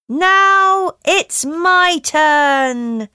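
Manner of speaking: the sun's big moment, slowly; slow, high, loud sun voice. Acted. Sun takes her turn.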